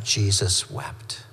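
a hushed, slow reading of the shortest verse; solemn and hushed. Acted. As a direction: say it gently, as if the grief weighs on it.

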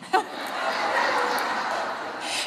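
quiet airy laugh; breathy laugh. Real, not acted. Pastor after the punchline, soft breathy laugh. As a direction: laugh softly without voice.

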